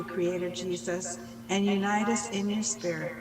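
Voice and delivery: ordinary delivery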